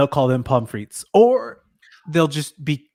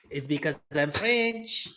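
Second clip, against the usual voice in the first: little French voice; 11 dB quieter than usual. Retold or imitated. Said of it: "make our voices sound so little".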